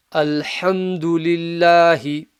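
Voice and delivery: slow, drawn-out recitation; Quran recitation demo. Shown as a demonstration. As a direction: recite it slowly, vowels held long. Reciting Quran example for learners.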